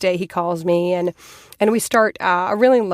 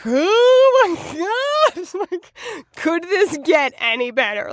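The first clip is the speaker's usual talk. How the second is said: very high, drawn-out giddy gush; retold swooning excitement; 11 semitones higher, slower than usual. Woman hearing her crush played pro hockey, giddily excited. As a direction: squeal it slowly, as if too good to be true.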